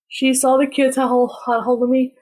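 usual speaking voice

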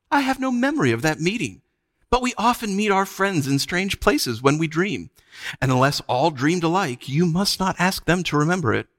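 high, lilting patient denial; sweetly condescending witch. A narrator's reading. Witch dismissing Scrub's memory as dream, sweetly condescending. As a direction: smoothly explain away his memory. Witch smoothly denies the meeting.

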